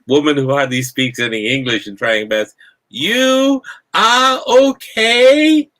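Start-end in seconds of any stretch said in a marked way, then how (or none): 2.9-5.7 high, booming, drawn-out mock shout; very loud, slow. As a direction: talk as if to someone hard of hearing. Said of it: "very loudly and very slowly".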